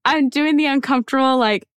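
high-pitched, nervous giggly voice fighting off tears; uncomfortable laughter. Real, not acted. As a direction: laugh uneasily, pitch jumping up, trying not to cry. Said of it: "I'm laughing 'cause I'm uncomfortable".

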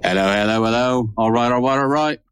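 host greeting listeners as a British McConaughey, lightly British; British Matthew McConaughey. Pretend. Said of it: "That was my, uh, British Matthew McConaughey".

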